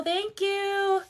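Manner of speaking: high, drawn-out singsong thank-you. Real, not acted. Thanks viewers mid-story in singsong.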